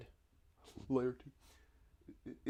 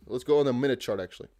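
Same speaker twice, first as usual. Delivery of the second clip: ordinary talk, nothing marked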